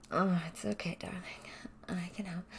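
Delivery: ordinary talk, nothing marked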